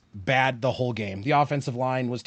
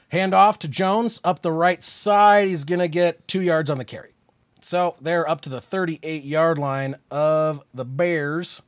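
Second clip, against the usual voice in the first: lively live football call, a bit louder; sportscaster calling the drive; play-by-play; 3 semitones higher, 4 dB louder than usual. Acted.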